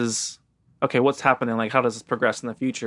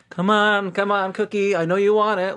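put-on higher, practised coaxing of a baby; worn-out Bob; 7 semitones higher than usual. Frazzled dad luring the baby back with a cookie, tired coaxing. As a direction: coax like an exhausted parent. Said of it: "Bob is so worn out".

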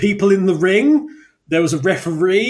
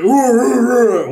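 short excited hoots, raised and louder; loud hooting imitation; 6 semitones higher, 6 dB louder, slower than usual. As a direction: hoot it out like a wrestler hyping up.

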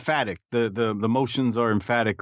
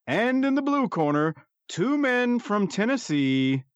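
lightly imitating a ring announcer, high and slow; a slow, drawn-out ring introduction, pitched up; ring announcer intro; 6 semitones higher, slower than usual. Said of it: "he had a little more excitement in his voice than you just did".

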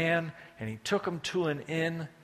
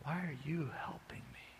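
soft, unvoiced disbelieving whisper; wounded man whispering in doubt; suspicious whisper; 10 dB quieter than usual. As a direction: ask it barely aloud. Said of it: "he said".

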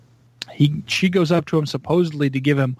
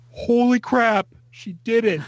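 higher pitched shocked exclamation; stunned disbelief; 8 semitones higher than usual. Retold or imitated. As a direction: blurt it out, caught off guard. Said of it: "And I was like".

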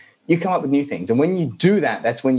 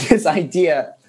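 ordinary talk

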